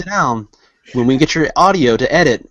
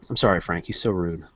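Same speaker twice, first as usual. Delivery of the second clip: ordinary talk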